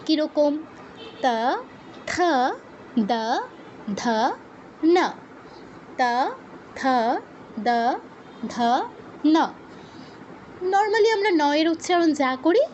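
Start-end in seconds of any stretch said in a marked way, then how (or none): 1.2-9.4 ত থ দ ধ ন isolated with long pauses; touch tongue to upper teeth for ত থ দ ধ ন; dental letter drill. Shown as a demonstration. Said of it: "উপরের পাটির দাঁতে জিহ্বা স্পর্শ করবে". (ত বর্গ, থেমে থেমে দন্ত্য উচ্চারণ)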